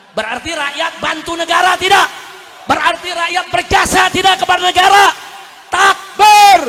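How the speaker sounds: loud, high rousing questions; rally the congregation to respond; shouted sermon climax; full-force preaching. Real, not acted. Rouses congregation to takbir.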